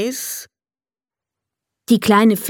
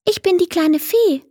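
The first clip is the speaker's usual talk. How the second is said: lightly voiced fairy, higher and kind; friendly little fairy; 9 semitones higher than usual. Fairy introduces herself to Emil, high and friendly. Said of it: "meinte sie freundlich".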